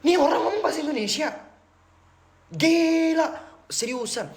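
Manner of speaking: loud, high-pitched disbelief; retold shock. Traveler amazed a stranger speaks Indonesian, shocked. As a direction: re-enact being floored, stretching the exclamation. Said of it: "terus aku kaget".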